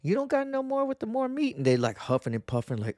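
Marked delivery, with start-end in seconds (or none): 0.0-1.6 much higher, sassy put-on voice as he mocks the butcher; high, pointed mock voice asking the butcher for meatier cuts; mock sassy customer. Telling the market butcher off, in a much higher mock sassy voice. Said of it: "I look at 'em and I'm like".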